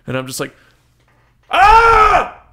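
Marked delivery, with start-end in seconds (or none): 1.5-2.4 huge high loud yell of frustration; explosive, very high, very loud shout; frustrated scream. Retold or imitated. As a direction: let out a wordless scream of exasperation. Frustrated outburst at girlfriend.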